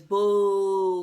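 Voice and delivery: drawn-out loud boo. Retold or imitated. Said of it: "And Tasha says".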